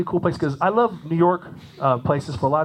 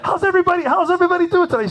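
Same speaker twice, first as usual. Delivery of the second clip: gushing stage greeting; 14 semitones higher, 3 dB louder than usual. Retold or imitated. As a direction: ham up a singer greeting the crowd. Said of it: "she started going".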